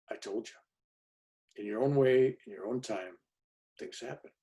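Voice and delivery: soft confiding half-whisper; hushed. Retold or imitated. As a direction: tell him quietly, almost under the breath. Narrator confides to weeping man.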